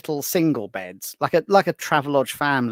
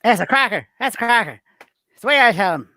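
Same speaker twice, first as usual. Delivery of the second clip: Frank Carson catchphrase; 5 semitones higher, faster than usual. Retold or imitated. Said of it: "Frank Carson".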